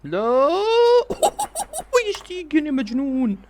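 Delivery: very high whoop of disbelief; amazed laughing cry. Real, not acted. Fan stunned by his crazy keeper, high amazed cry.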